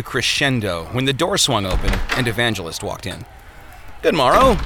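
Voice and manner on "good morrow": bright, loud, high hello; cheerful greeting. Acted. Visitor cheerfully greeting the struggling smith. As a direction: greet him with a cheery lift. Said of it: "he said cheerfully".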